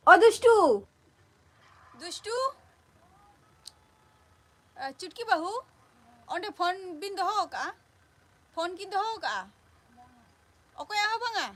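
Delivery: loud, high-pitched call. Real, not acted. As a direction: shout across to someone off mic. Calling out to someone off the stream.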